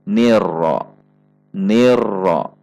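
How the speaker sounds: "nirro" with a light ni and a short rolled r; thin ni, moderate trill. Shown as a demonstration. Teacher models the joined reading, thin ni. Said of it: "ni-nya masih tipis".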